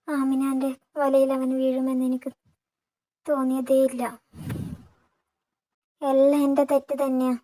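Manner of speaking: put-on old father, loud and grieving. A narrator's reading. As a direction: pour out his guilt, close to tears. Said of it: "തോളിൽ കിടന്ന് തോർത്തുകൊണ്ട് ... രണ്ട് കണ്ണുകളും തുടച്ചു".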